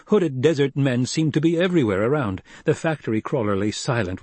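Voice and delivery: plain reading voice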